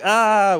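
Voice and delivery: loud, high "ah"; mock frantic yell. Passenger running up and down, frantic yell. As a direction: imitate a man losing it with a cry. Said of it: "just in that kind of like".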